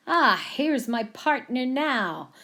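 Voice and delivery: slow cowboy drawl; amateur cowboy twang; attempted cowboy accent. A narrator's reading. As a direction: try a cowboy accent, not very well. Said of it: "attempting the accent once more".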